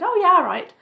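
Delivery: a scoffing self-quote; sarcastic retort. Retold or imitated. Retold reply to article request.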